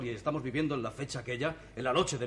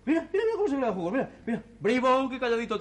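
fast, high, laughing wonder; delighted awe at a stomach at work; excited marvel; 9 semitones higher, 5 dB louder than usual. Acted. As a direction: gush over it like a miracle. Marvelling at digestion.

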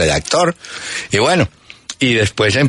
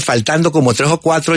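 ordinary talk

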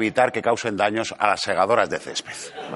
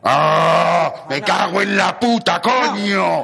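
burnt and cursing; 6 semitones higher, 5 dB louder, much slower than usual. Real, not acted. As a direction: yelp and swear loudly. Burnt by hot tea.

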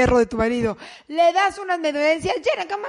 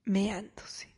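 whispered reveal; 5 semitones lower, 10 dB quieter than usual. Pretend. Puppy caught peeing.

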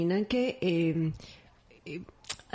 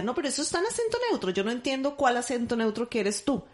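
mock correction; 3 semitones higher, faster than usual. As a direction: rattle it off as a mocking teacher. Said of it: "Frank le corregía y decía".

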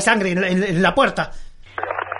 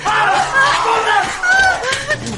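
high, fast yelling to flee; high frantic yell; panicked shout; 7 semitones higher than usual. Acted. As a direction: scream them into running. Fleeing, shouting orders.

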